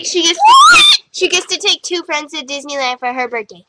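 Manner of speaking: usual speaking voice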